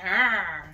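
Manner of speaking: loud imitated roar; lioness roar. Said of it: "You being a lioness".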